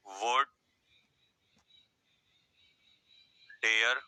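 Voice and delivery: slow dictation with long pauses. Shown as a demonstration. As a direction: dictate each word for notes. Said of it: "लिखो फटाफट". (लिखवाने का अंदाज़)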